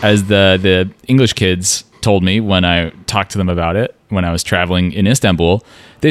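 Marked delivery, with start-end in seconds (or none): none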